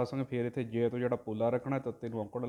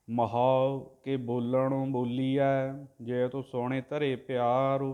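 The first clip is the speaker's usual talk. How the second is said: drawn-out devotional chanting, word by word; slow santhiya recitation of scripture; slow Gurbani recitation; 5 dB louder, much slower than usual. Shown as a demonstration. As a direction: chant each syllable slowly and evenly, like a granthi. Teacher chants the Gurbani line.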